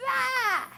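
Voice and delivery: high, loud "ah" of fright; mock terrified scream. Kids spotting big guys running at them, mock scream. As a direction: let out a sudden high mock shriek. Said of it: "just screaming".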